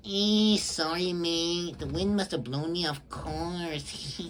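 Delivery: put-on voice, stretching out the last words; cartoon character voice. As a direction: play a cartoon character making a slow mock apology. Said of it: "So he talks like this".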